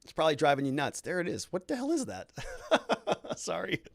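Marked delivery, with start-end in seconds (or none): 1.5-3.8 high, laughing disbelief; laughing surprise. Real, not acted. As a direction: burst into a real laugh at what you see. Surprised at a mark on screen.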